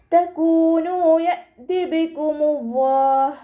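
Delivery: slow, drawn-out melodic tajweed chant; Quran recitation. Shown as a demonstration. Teacher models melodic Quran recitation for students. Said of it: "ഇവിടെ അലിഫ് സായിദയായിട്ടുള്ള".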